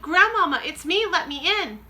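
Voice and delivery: loud, high urgent calling; shouting. Acted. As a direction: call through the door, urgent. Boy calls through door.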